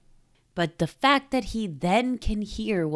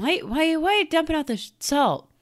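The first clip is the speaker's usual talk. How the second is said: raised, higher voice, half-acted; acting out a puzzled character, pitched up; high excited character voice; 11 semitones higher, faster than usual. Acting out the comic scene.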